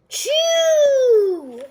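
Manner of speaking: loud, high sneeze burst; sudden big sneeze. Acted. Gerald bursting into a loud sneeze. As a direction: let the sneeze explode out.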